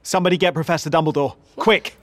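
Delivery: sharp, fast urgent order. Acted. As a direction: snap it out quickly. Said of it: "in a suddenly sharp voice".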